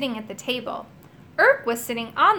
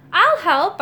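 high, loud, eager burst; keen and punchy; 8 semitones higher, 7 dB louder than usual. A narrator's reading. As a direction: jump in eagerly to volunteer. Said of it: "I said eagerly".

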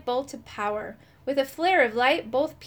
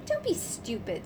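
snarl; 6 dB quieter than usual. A narrator's reading. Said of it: "snarled the bad part of me".

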